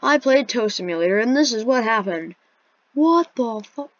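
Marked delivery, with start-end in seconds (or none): 2.9-3.9 startled swear. Real, not acted.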